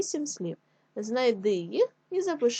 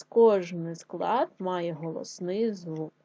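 slow, deliberate repeat of the rule; "кожний склад має голосний звук" said slowly and clearly; slower rule repeat; 3 semitones lower, slower than usual. Shown as a demonstration. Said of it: "Отже, ще раз".

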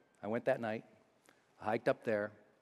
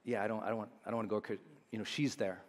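despondent; 2 semitones lower, 7 dB quieter, faster than usual. Retold or imitated. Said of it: "And I was like".

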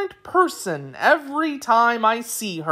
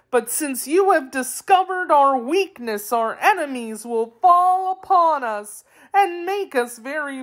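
sobbing; 9 semitones higher than usual. A narrator's reading. Wheeler confessing his secret to his captors, theatrical sobbing. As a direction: wail it like a helpless storybook creature. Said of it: "he sobbed".

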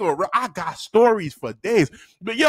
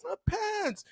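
high, very soft answer; small dutiful voice; meek pupil; 12 semitones higher, 11 dB quieter than usual. Retold or imitated. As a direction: imitate a cowed child answering teacher.

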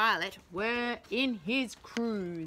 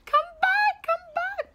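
much higher panicked shriek; shrieking teacher; 7 dB louder than usual. Acted. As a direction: shriek in panic as the play goes wrong. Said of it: "shrieked Miss Pie".

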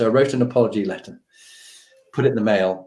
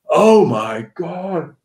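slow, drawn-out dread, pitched up; retold horrified realization; 4 semitones higher, slower than usual. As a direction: let the disaster sink in slowly. Retelling realization of missed lunch.

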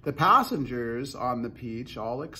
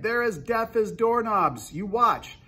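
high, loud scornful boast; raised, cocky cry; 8 semitones higher, 6 dB louder than usual. A narrator's reading. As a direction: shout your scorn for all to hear. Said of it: "he cried".

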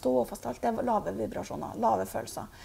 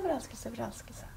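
softer echo, far below the call; 8 dB quieter than usual. Acted. Medium imitates the shouted word coming back, soft echo. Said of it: "så er det ekko".

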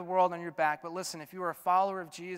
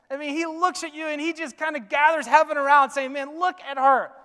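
loud, high, ringing pulpit voice; full-force preaching, high and loud; 10 semitones higher, 11 dB louder than usual. Acted. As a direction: preach it out over the whole room.

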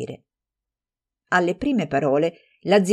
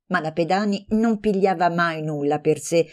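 ordinary delivery

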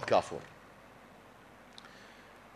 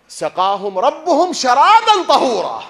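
high, loud, slow chanted verse; Quranic recitation; 11 semitones higher, 13 dB louder, slower than usual. Shown as a demonstration. Preacher recites the verse again for the congregation, high loud chant.

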